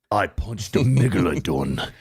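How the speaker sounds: put-on British action-star voice; mock hard-man boast; Jason Statham. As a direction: imitate a tough guy bragging.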